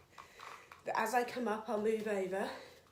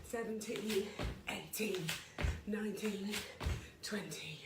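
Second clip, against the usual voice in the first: breathless rep count, quiet between breaths; 6 dB quieter, slower than usual. Real, not acted. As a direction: count through heavy breathing mid-workout. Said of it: "little bit out of breath".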